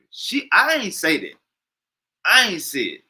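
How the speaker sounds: loud, heated protest; indignant denial. Real, not acted. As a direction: push back hard, offended. Indignant denial of her claim.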